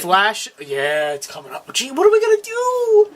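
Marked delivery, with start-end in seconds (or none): 1.7-3.1 high mock-worried whine. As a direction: play up fake panic.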